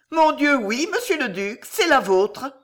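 comtesse ironique au sourire appuyé; sourire accentué. A narrator's reading. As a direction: attaquer haut et fort, en souriant avec ironie. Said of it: "dit-elle en accentuant son sourire". (ironie souriante, voix qui monte)

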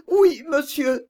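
tearful high yes with a sob; weeping. A narrator's reading. Agnès sobs her refusal to Stephenson's proposal. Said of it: "dit Agnès tout en pleurant".

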